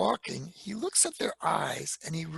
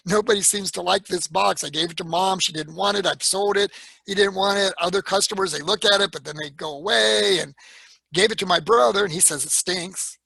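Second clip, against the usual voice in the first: louder, worked-up listing of complaints; exasperated rant; 2 semitones higher, 9 dB louder than usual. Retold or imitated. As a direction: vent it all out to her, louder. Exasperated retelling to girlfriend.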